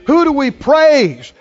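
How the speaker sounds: normal talking voice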